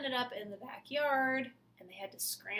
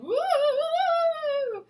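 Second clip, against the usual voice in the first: ghost woo; 15 dB louder than usual. Acted. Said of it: "the ghosts were saying".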